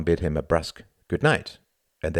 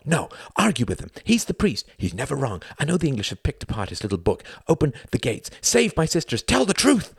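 manic pleading; 9 semitones higher than usual. A narrator's reading. Youngest brother grabs Tom's forearm, manic pleading. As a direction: beg him with frantic intensity. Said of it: "staring at the manic boy".

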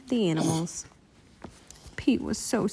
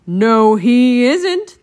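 very loud, emphatic denial; forceful shout, climbing at the end; crocodile's shout; 2 semitones higher, 10 dB louder, much slower than usual. A narrator's reading. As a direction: boom out the contradiction. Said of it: "the big crocodile shouted".

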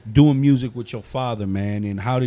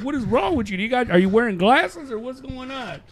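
mock disbelief; 10 semitones higher than usual. His reply retold.

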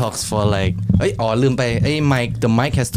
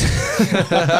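laughing; 5 semitones higher than usual. Real, not acted. Laughing at the takeover.

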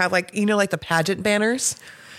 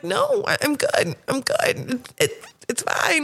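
higher, fading polite refusal; awkward refusal; 8 semitones higher, slower than usual. Retold or imitated. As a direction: politely wave it off, a bit overwhelmed. Declining gifts, voice catching.

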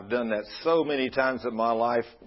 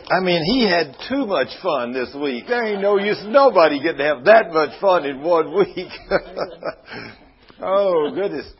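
loud, higher, amazed and amused; excited exclaiming; 6 semitones higher, 8 dB louder than usual. Real, not acted. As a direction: exclaim in delighted disbelief.

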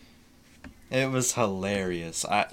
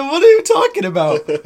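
put-on confused girlfriend; 12 dB louder than usual.

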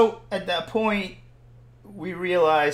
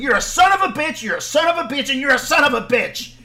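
high, loud repeated insult; re-enacted angry shouting; furious outburst; 8 semitones higher, 8 dB louder, faster than usual. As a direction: yell it over and over, out of control. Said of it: "and I was like".

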